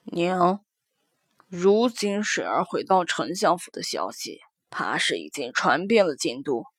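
a weary official father confiding; middle-aged man voice. Acted. Son answers his mother.

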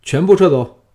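officer roaring at his troops to pull out; loud shout of command. Acted. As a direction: bellow the retreat order. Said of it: "大喝道".

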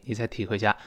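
usual speaking voice

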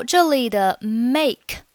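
ordinary talk, nothing marked

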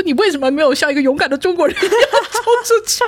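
hammed-up telling-off; mock scolding. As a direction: demand why she didn't charge out. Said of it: "我就大骂他".

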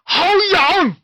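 loud high giant exclaim; loud booming giant cry; thunderous giant. A narrator's reading. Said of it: "声音大得跟打雷一样".

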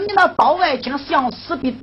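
ordinary talk

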